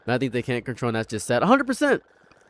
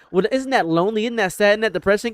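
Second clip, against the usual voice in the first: mock concerned questions; 7 semitones higher than usual. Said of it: "And people say".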